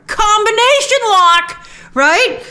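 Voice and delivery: high, slow and loud, impressed by the lock; excited. Real, not acted. Reacting to the trailer's combination lock, slow and delighted. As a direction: draw it out, delighted. Admiring a clever trailer detail.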